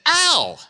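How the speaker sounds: high-pitched yelp of pain; acted "ow" shooting up in pitch. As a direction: cry out at a crushed foot. Foot crushed against the wall.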